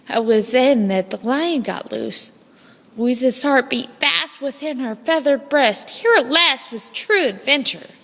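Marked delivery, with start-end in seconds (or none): none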